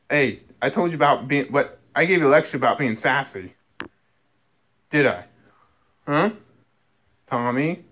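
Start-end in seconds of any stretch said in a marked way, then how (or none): none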